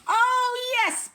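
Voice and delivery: high, eager piped reply; piping voice. Acted. Tiny mayor eagerly answering the bear. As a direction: pipe up brightly. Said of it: "piped the voice".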